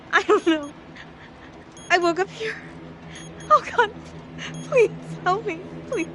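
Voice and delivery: panicked pleading. Acted. Victim who woke up trapped begs rescuer, panicked. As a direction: plead for help in a rising panic.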